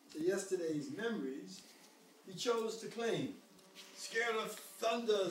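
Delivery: gentle storybook reading; reading to a toddler. A narrator's reading. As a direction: read it like a bedtime story. Reads the picture book aloud.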